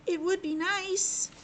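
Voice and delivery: put-on high, slow, hopeful small boy; a little boy hoping aloud; boy character voice. Albert answers the frog.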